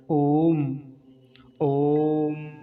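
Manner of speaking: "ओम" held long on one steady note; sustained chant-like "ओम"; prolonged Om. Shown as a demonstration. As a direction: stretch the o, then close on m. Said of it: "लगभग तीन second तक होगा".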